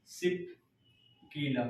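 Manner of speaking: soft, split in two with a long pause; dictation. Shown as a demonstration. Teacher giving students time to write the pass name, broken dictation. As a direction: dictate it low and piece by piece. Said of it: "लिख लीजिएगा".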